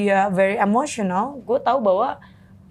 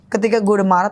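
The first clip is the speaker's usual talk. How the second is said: ordinary talk, nothing marked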